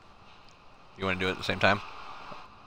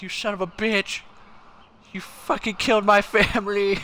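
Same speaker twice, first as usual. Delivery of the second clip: mock furious yell as the chasing dog, higher; hammed-up outraged dog yelling at the truck; dog's angry yell; 11 semitones higher, 4 dB louder than usual. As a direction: streamer voicing the dog in a mock rage. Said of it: "He's like".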